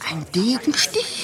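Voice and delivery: a whispered, frightened question; a servant horrified by news of the murder; shocked whisper. Acted. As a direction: ask it under your breath.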